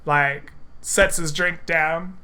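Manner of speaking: ordinary talk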